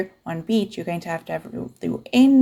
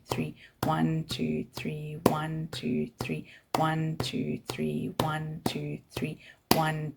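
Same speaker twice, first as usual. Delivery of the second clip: whispered counting; 8 dB quieter, much slower than usual. Shown as a demonstration. Teacher whispering the count as the class claps. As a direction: whisper the beats steadily while clapping. Counting beats while clapping.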